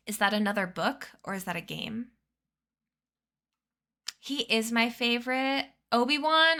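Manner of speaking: ordinary talk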